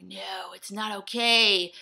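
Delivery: scolding herself like a soldier; harsh inner voice. Retold or imitated. Inner critic ordering her to make the video, hammed-up loud. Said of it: "I'm like".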